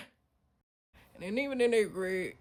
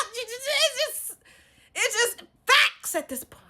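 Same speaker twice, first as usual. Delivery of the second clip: high, loud chanting escalation; loud, pitched-up comic insistence; hammed-up proclamation; 10 semitones higher, 10 dB louder than usual. Shown as a demonstration. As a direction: holler each item like proof in court, climbing higher. Hammed-up rant listing proof.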